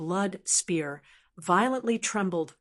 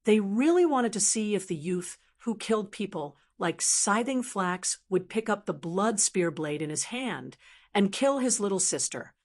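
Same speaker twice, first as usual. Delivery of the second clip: ordinary delivery, nothing marked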